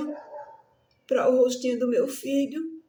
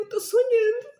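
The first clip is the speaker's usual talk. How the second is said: crying; 11 semitones higher than usual. Retold or imitated. Fearing son died, breaking down.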